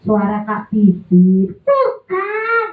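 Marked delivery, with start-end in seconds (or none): 1.6-2.7 high, sulky puppet insistence; mock angry puppet. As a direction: protest hotly in a high puppet voice. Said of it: "Jangan marah dong".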